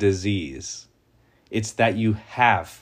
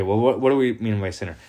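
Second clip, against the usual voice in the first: normal talking voice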